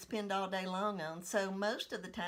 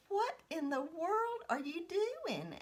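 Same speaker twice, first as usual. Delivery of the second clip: higher, slow, astonished; shocked mother; 10 semitones higher, slower than usual. Retold or imitated. Mother at the door seeing her child, shocked slow question. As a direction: draw it out in disbelief.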